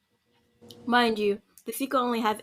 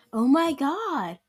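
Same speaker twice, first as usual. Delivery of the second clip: higher-pitched, exaggerated disbelief; mock weirded out; 6 semitones higher, slower than usual. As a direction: play someone weirded out by tears, drawing out the start. Acts out judging a crying boyfriend.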